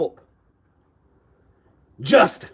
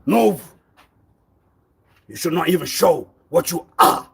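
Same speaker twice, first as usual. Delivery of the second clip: ordinary delivery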